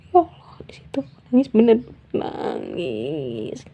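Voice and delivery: tearful whisper. Real, not acted.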